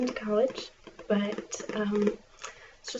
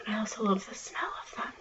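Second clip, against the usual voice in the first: library whisper; 3 dB quieter than usual. Acted.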